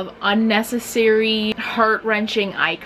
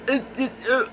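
awkward stammer; 3 semitones higher, 4 dB quieter, much faster than usual. Acted. Acting out awkward fumbling apology.

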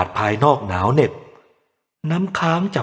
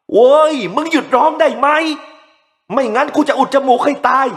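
much higher, louder frantic yelling; furious drunken scream; 14 semitones higher, 4 dB louder than usual. A narrator's reading. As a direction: scream threats at the crying baby. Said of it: "ทั้งขู่ตะคอกว่า".

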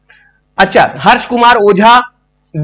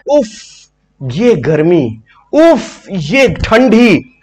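drawn-out filmy sigh of uff; swooning uff, mock dramatic; hammed-up lovesick sigh; 3 semitones lower, much slower than usual. Mock sighing "uff" lines.

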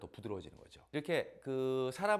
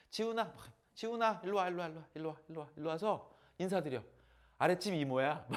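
put-on high, loud call to the kid; mother calling her son to greet the neighbor; calling the child; 5 semitones higher, 5 dB louder than usual. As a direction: call Jihoon over to say hello.

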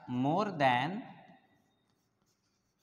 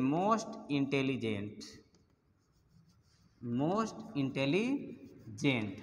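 "most intelligent" said very slowly and softer, stretched out; slow dictation; 4 semitones lower, 5 dB quieter, much slower than usual. Shown as a demonstration. Teacher slowly dictating the example to students. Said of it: "dictating the superlative form".

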